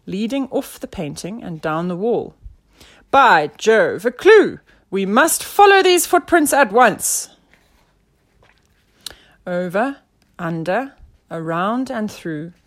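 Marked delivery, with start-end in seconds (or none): 3.1-7.3 loud, high detective exclamation; rally everyone to the chase; thrilled inspector on a trail; excited discovery. A narrator's reading.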